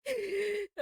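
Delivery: high, loud laugh through the words. Real, not acted. Streamer excusing a long delay to a friend, laughing. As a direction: talk through helpless giggles, drawn out.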